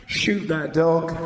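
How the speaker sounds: ordinary talk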